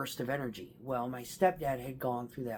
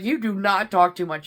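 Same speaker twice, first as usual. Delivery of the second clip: loud, higher retold objection; emphatic contradiction; 5 semitones higher, 10 dB louder than usual. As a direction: firmly set her straight, raising your voice. Retold firm contradiction of her niece.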